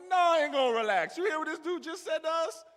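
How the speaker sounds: loud high protest, losing it; upset. Retold or imitated. Rookie shouting back at his teammate, outraged. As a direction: raise your voice, outraged. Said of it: "I'm like".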